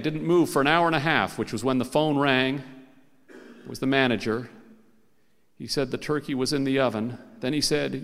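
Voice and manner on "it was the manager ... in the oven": usual speaking voice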